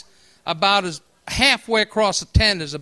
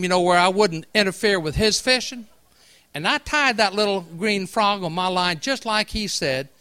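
usual speaking voice

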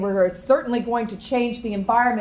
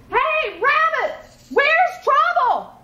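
high, slow worried call; worried Gator; 3 dB louder, much slower than usual. Acted. Gator calls to Rabbit as things heat up, high worried call. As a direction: stretch it out, the gator getting nervous.

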